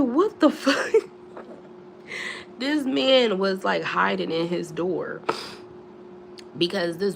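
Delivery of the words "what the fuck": high, slow, laughing through the question; laughing disbelief. Retold or imitated. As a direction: crack up mid-question. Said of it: "I was like".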